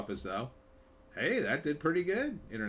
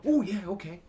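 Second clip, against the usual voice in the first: eager Russians; 7 semitones higher, 5 dB louder than usual. Acted. Russians voiced accepting a movie.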